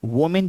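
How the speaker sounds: वोमेन said "wo-min" with an O; wrong pronunciation. Shown as a demonstration. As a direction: give the first vowel of वोमेन an O sound, wo-min. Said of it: "ये गलत pronunciation है".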